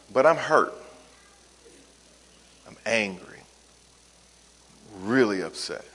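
low, soft admission with long pauses; fatigued, exasperated. Shown as a demonstration. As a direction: confess the hurt wearily, voice dropped. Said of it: "either exasperation or fatigue".

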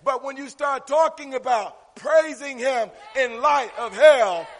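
loud, rising preacher's delivery; full-force preaching. Real, not acted. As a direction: preach it hard to the congregation.

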